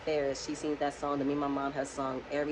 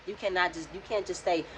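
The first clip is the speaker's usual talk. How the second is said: ordinary talk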